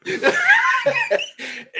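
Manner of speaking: high loud cackle; shrieking laugh. Real, not acted. Cohost howling at the dirty joke.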